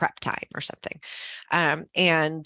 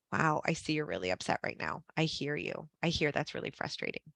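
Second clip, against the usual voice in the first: soft, gentle validation, much quieter; calm validating voice; 8 dB quieter than usual. Shown as a demonstration. Administrator calming an upset parent, softly reassuring. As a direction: soothe them, let it land softly. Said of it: "a lot of that is just validating".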